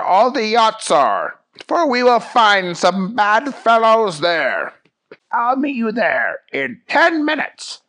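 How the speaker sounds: high, emphatic cartoon hero; Fish Guy. Fish Guy briefs Jerry on the thieves, grandly and high.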